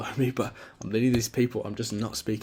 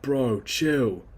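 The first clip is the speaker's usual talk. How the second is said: squeezed from the throat, louder bro impression; odd throat-made bro voice; 3 semitones higher, 7 dB louder than usual. Shown as a demonstration. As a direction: push it out through the throat like a bro. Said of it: "it's so weird how I'm doing that with my throat".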